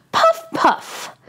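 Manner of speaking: high airy puff puff like a kettle; breathy kettle puff. Acted. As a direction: puff out the steam sound. Kettle sound effect.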